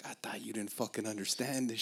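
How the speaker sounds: unvoiced whisper to yourself; hushed mock accusation; whispered aside. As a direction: whisper it like a private gotcha. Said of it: "And it's like".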